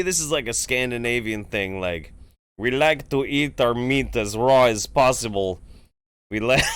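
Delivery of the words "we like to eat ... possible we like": put-on Swedish accent. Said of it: "don't know if I could do a Swedish accent".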